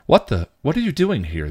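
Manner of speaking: a touch of startled surprise; surprised. A narrator's reading. Intern finds them in the ward, surprised.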